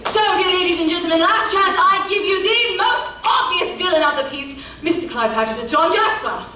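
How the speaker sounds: melodrama narrator. Acted. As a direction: boom the villain's introduction. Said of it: "We will ruin your evening".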